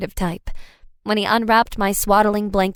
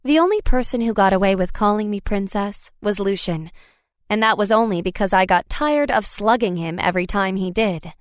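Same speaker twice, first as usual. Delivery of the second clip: usual speaking voice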